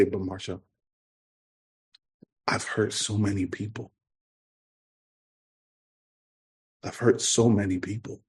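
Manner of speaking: softly retold, pained admission; hushed confession. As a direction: confess it quietly, with long pauses. Retold tearful confession to his counselor.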